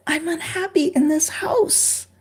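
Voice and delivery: quiet retold confession, a touch tearful. Wife admitting her unhappiness to her husband, softly tearful. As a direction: let it out softly, as if starting to cry. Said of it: "and I start to cry".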